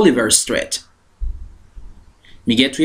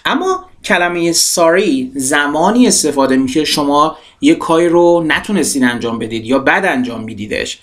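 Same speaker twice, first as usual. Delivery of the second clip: usual speaking voice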